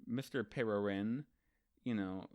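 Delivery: ordinary delivery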